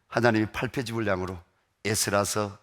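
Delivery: ordinary talk, nothing marked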